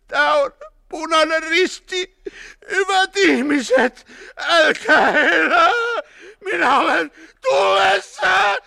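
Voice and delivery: high, loud, drawn-out crying plea; pleading wail. A narrator's reading. Burning man appealing to the Red Cross, wailing. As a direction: wail for mercy, stretching the words. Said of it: "muuttui rukoilevaksi vikinäksi".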